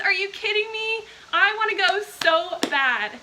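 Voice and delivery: high, loud, thrilled burst; envious squeal of wanting to go; real excitement at a viewer's concert story; excited. Real, not acted.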